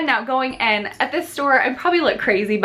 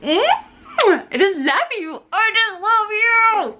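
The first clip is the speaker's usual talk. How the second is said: tearful; 12 semitones higher, much slower than usual. Real, not acted. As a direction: voice breaking with tender tears. Mom tearing up at baby.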